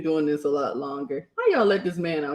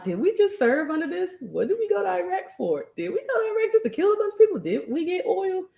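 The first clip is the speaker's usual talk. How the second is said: rising, higher questions, slowing at the end; incredulous outrage; 10 semitones higher than usual. Retold or imitated. Veteran questioning why they served, incredulous higher voice. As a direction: pile up the questions in disbelief.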